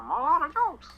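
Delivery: mock Joker voice. Joker quip mocked.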